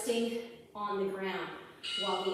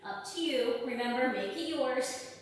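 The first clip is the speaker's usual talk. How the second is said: ordinary talk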